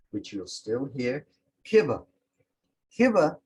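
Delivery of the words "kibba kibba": Kibba said kib-ba, short i and b for v; dialect word kibba. Shown as a demonstration. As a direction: Seminole Kibba for cover. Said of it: "is from cover".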